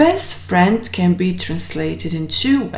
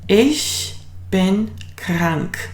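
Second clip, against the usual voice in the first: very slow, clear Ich bin krank; slow it right down for learners; slow model sentence; much slower than usual. Shown as a demonstration. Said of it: "how are you going to say".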